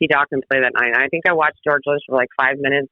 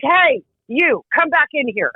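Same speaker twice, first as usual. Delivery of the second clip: retold yell; 9 semitones higher, slower than usual. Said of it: "he yells at me".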